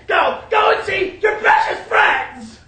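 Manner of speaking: loud, high, drawn-out send-off; slow, scornful yell; bitter shouted dismissal. Acted. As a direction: bellow it as a wounded mother banishing her son. Mother dismisses son angrily.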